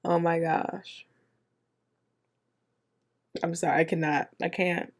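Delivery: normal talking voice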